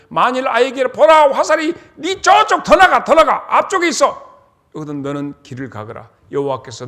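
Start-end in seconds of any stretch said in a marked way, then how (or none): 0.1-4.3 put-on much higher and louder, as if shouting to the boy; loud shouted reading. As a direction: preach the signal like a cry across a field. Reading the shouted arrow signal.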